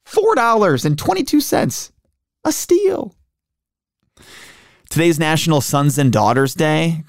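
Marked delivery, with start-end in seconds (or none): 0.0-1.9 high mock-delighted price; sarcastic amazement. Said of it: "I was like". 2.4-3.2 very high mock-thrilled squeak; sarcastic squeal. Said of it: "I was like".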